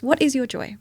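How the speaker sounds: normal talking voice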